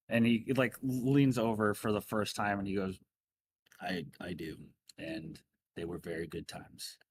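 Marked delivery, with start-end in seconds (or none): 3.8-7.0 soft tender reply. Acted. Gruff Mordecai tender aside.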